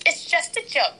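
high-pitched imitation of a defensive poster; mock defensive poster. Woman imitating a girl defending her online post, mocking.

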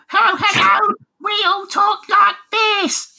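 very high, loud squeaky critter; annoying creature voice. Retold or imitated. Mocking guinea piggy fellas in the game, annoying squeaky voice. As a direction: squeal it slowly as an irritating little creature. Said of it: "some annoying voice acting in it".

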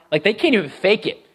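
normal talking voice

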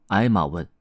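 usual speaking voice

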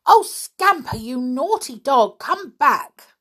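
high, loud scolding call; calling the dog back. A narrator's reading. Girl calling her naughty dog back, loud.

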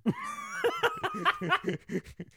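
high, shrieking burst of laughter; loud laugh. Real, not acted. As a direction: let out a loud, high cackle.

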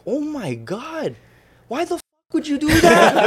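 a thrilled mother half scolding; shocked mom. Retold or imitated. Mother hearing her son gave up his redshirt, high and shocked.

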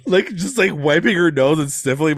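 usual speaking voice

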